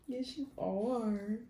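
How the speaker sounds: soft, slow pet talk; praising the dog. Real, not acted. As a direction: coo it softly to a good dog. Praising her dog off-mic.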